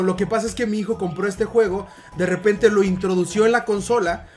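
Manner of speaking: ordinary talk, nothing marked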